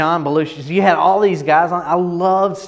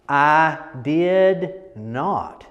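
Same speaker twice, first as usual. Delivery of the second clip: very slow, drawn out I did not; slow, extended I did not; stretched out; much slower than usual. Shown as a demonstration. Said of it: "turning it into this".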